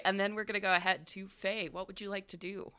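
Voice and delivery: ordinary delivery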